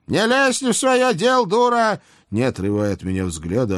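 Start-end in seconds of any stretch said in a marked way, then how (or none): 0.1-2.0 put-on high snarling old hag; snapping old witch. A narrator's reading. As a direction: snap at her like a witch. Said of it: "огрызнулась яга".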